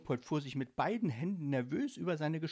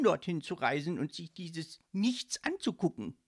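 raised, high insistent voice; energetic protest; 7 semitones higher, 5 dB louder than usual. A narrator's reading. Ghost justifying his refusal of the trip, energetic protest. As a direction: argue your case forcefully. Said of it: "rief Leopold energisch".

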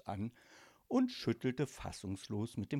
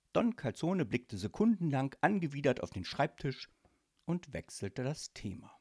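ordinary delivery, nothing marked

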